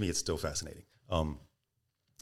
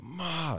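"ma" high, then falling; mock-angry "ma" dropped from a high start; angry fourth tone; 11 semitones higher than usual. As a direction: imitate a teacher's exasperated falling "ma". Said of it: "Say like you're angry".

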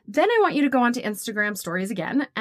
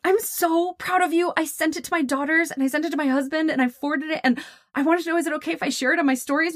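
high, gushing praise; effusive retold delight in a high voice; proud client; 7 semitones higher than usual. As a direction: imitate a thrilled client, pitched well up.

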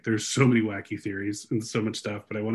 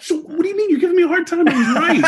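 much higher, louder, fast with laughter; triumphant laughing tease; 9 dB louder than usual. Real, not acted. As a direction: gloat that you were right, laughing. Teasing triumph.

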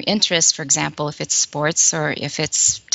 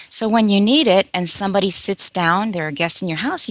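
ordinary talk, nothing marked

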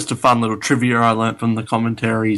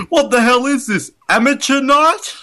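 quoting the trainer's insult, much higher and laughing; hammed-up sneer, much higher, laughing through it; mock insult; 4 dB louder than usual. Podcaster quoting a film insult, laughing, much higher. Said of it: "one of them says".

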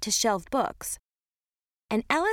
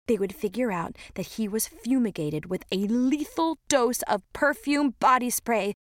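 ordinary delivery

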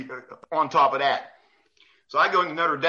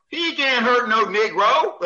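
slow, drawn-out coach voice; slow and incredulous, in character; imitating John Thompson; 6 semitones higher, 4 dB louder, slower than usual. Coach asking the ref about Bob Knight, slow. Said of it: "he goes".

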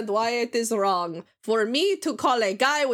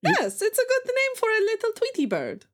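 cute bird voice; 13 semitones higher than usual. Pretend.

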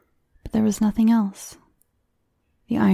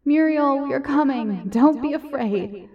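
high, reassuring call into the house; calling encouragingly; 7 semitones higher than usual. Acted. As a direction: call out to comfort her. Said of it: "They began to call encouragingly".

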